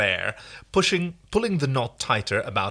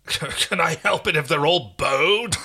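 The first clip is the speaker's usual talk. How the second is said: peevish snap; 5 semitones higher, 4 dB louder, slower than usual. Acted. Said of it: "Rigel snaps peevishly".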